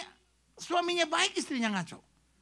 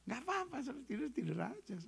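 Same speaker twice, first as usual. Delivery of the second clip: soft, fast reassurance; 14 dB quieter, faster than usual. Acted. Preacher soothing sleepy listeners.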